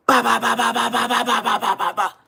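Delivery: rapid high, loud "ba-ba-ba" bursts; machine-gun vocal rattle; imitated gunfire. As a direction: mimic an automatic weapon with your mouth. Imitates gunfire intro of his videos.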